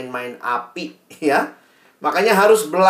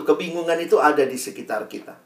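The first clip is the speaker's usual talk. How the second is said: usual speaking voice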